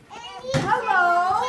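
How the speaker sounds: high parrot hello; squawky high hello for the children. Pretend. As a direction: chirp it like a talking parrot. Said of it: "So you're gonna be a parrot".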